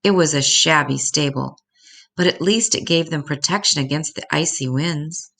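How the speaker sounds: ordinary delivery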